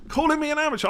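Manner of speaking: high indignant squeal of disbelief; playful outrage, voice shooting up high. Pretend. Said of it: "repeats it higher".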